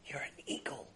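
hushed, secret whisper. Acted. Farmer's customer telling the bird what it is, whispering. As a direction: whisper it, barely any voice. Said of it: "he whispers to the bird".

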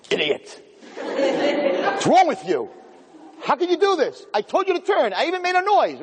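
high, laughing mock tirade at a driver; mock screaming GPS. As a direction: ham up a furious nav voice yelling at you. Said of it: "gonna start screaming".

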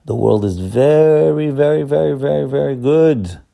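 God praising His creation, piling on every very; emphatic very very good. Acted. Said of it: "and he said".

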